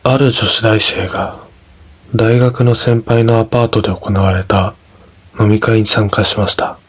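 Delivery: ordinary delivery, nothing marked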